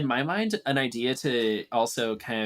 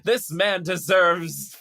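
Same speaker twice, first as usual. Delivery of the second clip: louder, higher, slow courtroom plea; demon prosecutor; 3 semitones higher, 5 dB louder than usual. Retold or imitated. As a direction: argue to the judge like a pompous lawyer. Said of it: "they're like".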